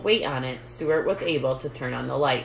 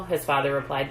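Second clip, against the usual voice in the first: plain reading voice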